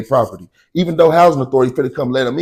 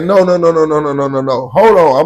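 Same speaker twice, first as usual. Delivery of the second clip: frantic denial; 2 semitones higher, 3 dB louder than usual. Retold or imitated. His retold denial to police.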